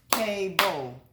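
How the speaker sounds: "Cable" in two separated beats, cay-bull; syllable by syllable. Shown as a demonstration. Teacher asking pupils to count, "Cable" broken in two syllables. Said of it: "How many syllables was that?".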